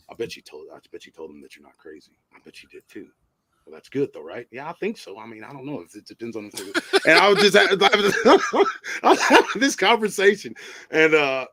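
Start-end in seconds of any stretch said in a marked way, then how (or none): 0.1-3.1 very low, hushed crazy muttering; dry self-conversation. Acted. Prankster talking to himself before a party guest, low dry mutter. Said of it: "whole dry ass conversation with me". 3.6-6.7 soft, matter-of-fact reply to himself; dry self-conversation. Acted. Prankster answering his own voices at a party, quiet dry murmur. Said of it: "with me, me, and this one girl".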